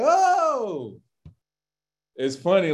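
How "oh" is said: high, drawn-out congregation "Oh"; the whole church going oh in awe; congregation's mock oh. Imitating the congregation answering the preacher, mock awe. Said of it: "Everybody's like".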